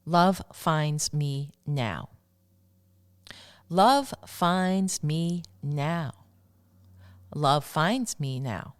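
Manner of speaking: very slow, emphatic affirmation; drawn-out mantra for listeners to repeat. Shown as a demonstration. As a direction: declare it one word at a time. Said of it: "The third affirmation I'd like to share".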